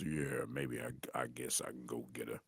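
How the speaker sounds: low, soft deep grown man's voice; sounding like his father. Retold or imitated. A boy answering the phone in his father's deep voice. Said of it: "I started sounding like my father".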